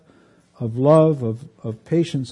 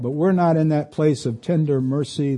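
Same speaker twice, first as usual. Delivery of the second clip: ordinary talk, nothing marked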